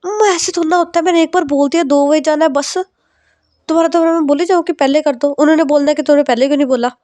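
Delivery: whiny it-doesn't-work-like-that; exasperated pushback. Acted. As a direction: daughter protesting to her mother, pitched high. Daughter pushing back at mother.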